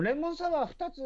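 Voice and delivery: high cute order call; chirpy waitress taking an order. Retold or imitated. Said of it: "とかって言ったんだろ".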